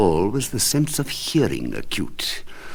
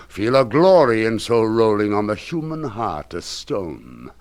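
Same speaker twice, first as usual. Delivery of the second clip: ordinary delivery, nothing marked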